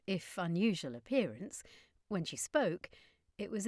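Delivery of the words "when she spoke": ordinary delivery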